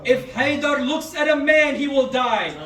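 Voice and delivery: loud, high, rising declaration of power; thundering proclamation. Acted. Preacher voicing Imam Ali, thundering back at Marhab's taunt. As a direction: hurl each line at the enemy, hammering he will die.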